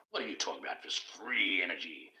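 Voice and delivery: ordinary talk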